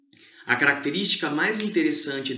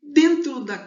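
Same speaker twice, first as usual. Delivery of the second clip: loud restart; 11 semitones higher, 8 dB louder than usual. Real, not acted. Teacher restarts loudly.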